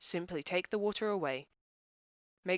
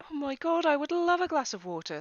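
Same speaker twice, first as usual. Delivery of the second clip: high, loud and delighted; gushing yes; 10 semitones higher, 6 dB louder than usual. Acted. Guest gushing about being offered water, delighted and loud.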